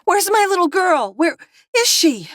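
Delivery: high and loud, frantic; a mother's terror climbing; mother panicking over her child; rising panic. A narrator's reading. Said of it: "her voice rising in pitch with panic".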